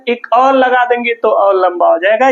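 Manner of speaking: normal talking voice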